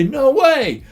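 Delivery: high, emphatic refusal; acted refusal. Shepherds turn down Jacob's request to leave, emphatic. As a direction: exclaim it with pitch jumping up.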